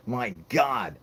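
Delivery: usual speaking voice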